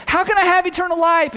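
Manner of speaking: eager seeker. Retold or imitated. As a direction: play an eager stranger begging to know.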